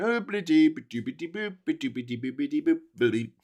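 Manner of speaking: soft nonsense babble as a useless court; quiet, singsong mock waffle; mock dithering. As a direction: burble gibberish like someone dodging the question. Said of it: "the Supreme Court went".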